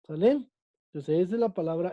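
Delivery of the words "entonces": normal talking voice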